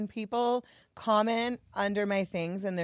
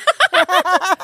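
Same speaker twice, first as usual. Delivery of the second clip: high loud laughing burst; 12 semitones higher, 14 dB louder than usual. Real, not acted.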